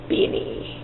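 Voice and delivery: breathy whispered beanie. Shown as a demonstration. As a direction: whisper the word beanie to yourself. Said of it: "That's fun to say".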